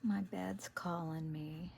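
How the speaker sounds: soft ASMR murmur; ASMR whisper. Acted. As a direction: whisper it to the viewers as you craft. Whispered crafting vlog chat.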